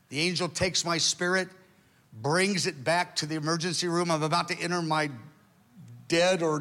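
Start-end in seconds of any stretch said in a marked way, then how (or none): none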